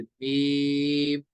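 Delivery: "मीम" with a long-held ee; three-alif elongation. Shown as a demonstration. Teacher demonstrating madd lazim to students, drawn out. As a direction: stretch "मीम" on a steady note. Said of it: "तो ये हो गया तीन अलिफ".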